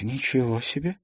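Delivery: soft, stunned murmur; young journalist shocked; stunned mutter. A narrator's reading. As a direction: mutter in disbelief. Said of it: "потрясённо пробормотала Карина".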